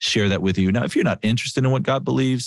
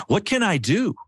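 much higher and eager, rising to help; eager offer; 12 semitones higher than usual. Retold or imitated. Wife rolling down the window to help, eager and bright. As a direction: leap at the chance to help. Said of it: "she goes".

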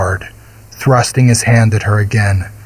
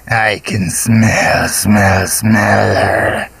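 slow, rasping monster sniffing; much slower than usual. A narrator's reading. The monster Golgoroth catching the girl's scent, slow and rasping. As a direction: savor each smell like a hungry beast. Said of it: "Golgoroth said".

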